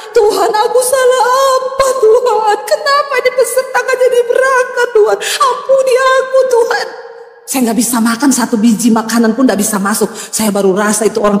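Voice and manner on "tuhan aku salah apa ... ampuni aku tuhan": a mother reliving her desperate cry to God; retold crying to God. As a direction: sob it out as a lament. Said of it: "Saya nangis teriak".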